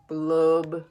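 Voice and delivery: low, slow glum fish blub. Acted. Reading to a child, the sulky fish goes low on blub. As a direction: drop the voice low and let each blub sink.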